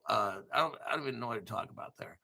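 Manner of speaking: ordinary talk, nothing marked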